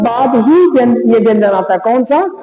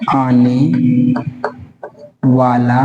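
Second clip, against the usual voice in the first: slow dictation; 10 semitones lower, much slower than usual. Shown as a demonstration. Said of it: "dictation, drawn out words".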